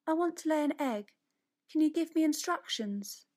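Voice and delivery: shy rabbit eagerly asking the chickens; little rabbit asking. A narrator's reading. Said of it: "she asked the chickens a question".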